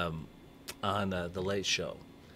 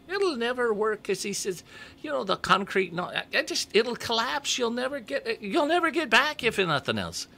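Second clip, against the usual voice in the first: put-on very high, louder squeaky old elf; leprechaun voice; 3 dB louder than usual. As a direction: pitch way up into a comic leprechaun. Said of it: "great leprechaun voice".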